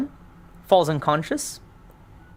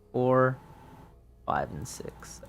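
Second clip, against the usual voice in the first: whispered count; 6 dB quieter, slower than usual. Real, not acted. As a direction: whisper the count under your breath. Counting survivors again, under breath.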